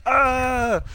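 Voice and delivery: high-pitched mock scream; imitated scream. Marty hits a scarecrow while driving, imitated scream. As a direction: let out a hammed-up startled scream.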